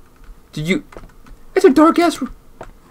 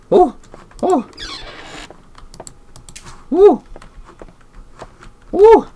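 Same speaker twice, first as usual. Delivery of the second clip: scared shrieks. Real, not acted. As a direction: shriek out in fear. Startled shrieks.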